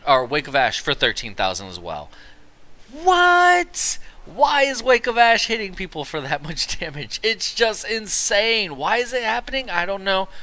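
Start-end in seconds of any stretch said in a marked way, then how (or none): 2.9-10.3 high, loud disbelief, stretching "insane"; incredulous outburst. Acted. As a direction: cry out in baffled amazement, laugh creeping in. Reacting to huge damage.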